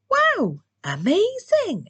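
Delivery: very high excited shout; squealing wow of amazement; amazed cheer. A narrator's reading. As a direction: burst out in wonder. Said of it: "everyone cheered".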